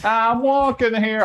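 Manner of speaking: New York accent. Shown as a demonstration. Streamer practicing his New York accent line. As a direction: stretch out "walking" like a New York cabbie. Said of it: "trying to learn a decent New York accent".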